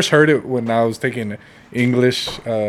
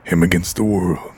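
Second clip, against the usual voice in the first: low, slow movie trailer announcer; 5 semitones lower, slower than usual. Shown as a demonstration. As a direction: epic coming-attraction voiceover, pitched down with dramatic pauses. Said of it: "Do the one man".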